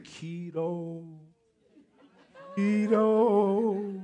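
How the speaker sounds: long drawn-out, hushed joke whisper from above; mock Holy Spirit voice. Preacher joking that the Holy Spirit pushes diets, mock spirit voice. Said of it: "Holy Spirit be saying".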